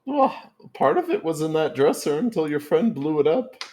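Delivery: high, loud granny voice; Grammy voice. Acted. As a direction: play a sweet old granny, high and bright. Grammy voice with laughter.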